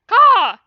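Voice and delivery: loud, high imitated caw; bird caw. A narrator's reading. Lightning calling outside the cave, loud caw. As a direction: squawk out a sharp call like a bird. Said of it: "called Lightning".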